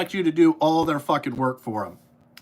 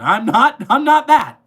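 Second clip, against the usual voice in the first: shouting; 4 semitones higher, 8 dB louder than usual. Real, not acted. Rant continues, shouting.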